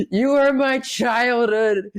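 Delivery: high, slow, choked-up; crying into his hero's shoulder; tearful fanboy. Retold or imitated. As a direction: sob it out with joy.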